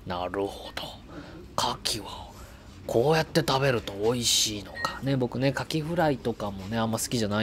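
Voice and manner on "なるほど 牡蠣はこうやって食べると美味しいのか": lightly voiced gourmet inner monologue; quiet, slow musing over food; Goro narration voice. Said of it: "五郎さんのナレーション".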